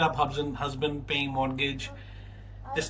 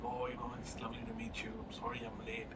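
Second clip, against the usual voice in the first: whispered, much softer, a polite apology; hushed whisper; 3 semitones lower, 17 dB quieter than usual. Retold or imitated. Boss apologizing to the candidate, whispered and soft. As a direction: boss murmuring sorry for being late, whispering.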